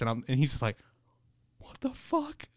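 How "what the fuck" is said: stunned whisper; hushed disbelief. Retold or imitated. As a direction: breathe it out in shock.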